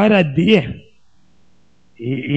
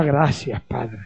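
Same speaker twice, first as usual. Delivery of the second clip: almost whispered thanks, much softer; barely audible gratitude; hushed prayer; 7 semitones lower, 9 dB quieter, slower than usual. Retold or imitated. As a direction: breathe it out to God in awe. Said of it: "Y yo le decía".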